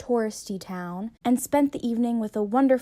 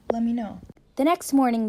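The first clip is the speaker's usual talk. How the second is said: ordinary talk, nothing marked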